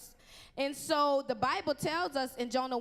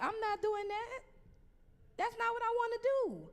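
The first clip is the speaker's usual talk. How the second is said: high-pitched whiny refusal, much higher; stubborn refusal; 9 semitones higher than usual. Pretend. Preacher playing a teen refusing God, high and whiny.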